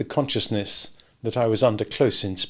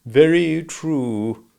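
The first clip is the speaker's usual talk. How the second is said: slow, weighty agreement; grave and emphatic; 4 semitones higher, much slower than usual. A narrator's reading. Silent lady agrees with Raymond, slow and grave. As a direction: stretch out each word gravely. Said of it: "said gravely and emphatically".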